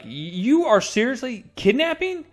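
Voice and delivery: high, outraged disbelief; livid. Retold or imitated. Johnny catching Kitty taking his nephew, livid and high-pitched. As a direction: sputter it in outrage. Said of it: "and he is livid".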